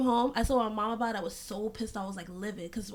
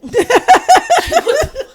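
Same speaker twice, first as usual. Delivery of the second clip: laughing; 17 dB louder, much slower than usual. Real, not acted. Laughing at the memory.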